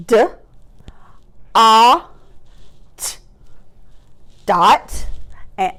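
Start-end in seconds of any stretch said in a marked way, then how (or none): 0.1-3.2 "d, a, t" as three isolated sounds; chopped-out word. Shown as a demonstration. Teacher doing one more word, chopped apart. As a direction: sound out DOT with gaps. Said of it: "let's do one more".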